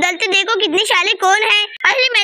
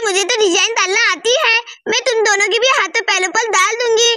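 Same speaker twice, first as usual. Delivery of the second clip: ordinary delivery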